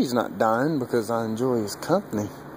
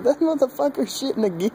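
laughing disbelief; 13 semitones higher, 3 dB louder than usual. Retold or imitated. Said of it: "and I just started laughing".

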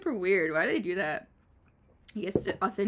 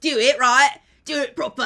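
very loud British hooligan order; football hooligan; 4 semitones higher, 13 dB louder than usual. Acted. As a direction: demand it like a rowdy football fan. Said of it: "you do the football hooligan".

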